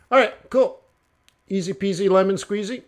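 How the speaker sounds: usual speaking voice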